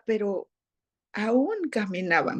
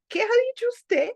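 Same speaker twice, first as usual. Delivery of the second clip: much higher, affronted question; indignant challenge; 11 semitones higher than usual. Retold or imitated. Employer confronting her nanny who refused Paris, imitated high indignant challenge. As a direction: pitch it up high like an affronted boss.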